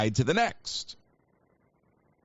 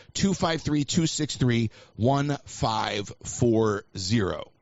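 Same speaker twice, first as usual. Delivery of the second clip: slow right down to a drawn-out last group; slowing number read-out; slower than usual. Real, not acted. Host repeats the call-in number to listeners, slowing to a crawl. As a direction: repeat the number, stretching ONE FIVE FOUR ZERO.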